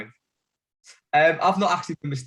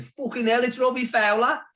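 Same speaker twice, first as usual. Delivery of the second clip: put-on thick Brummie accent, pitched up; 12 semitones higher than usual. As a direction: shout it in a dead Brummie. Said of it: "thick Brummie accent".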